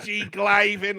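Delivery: high, loud nerdy squeal; Professor Frink. Retold or imitated. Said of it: "They've given him a real nerdy voice".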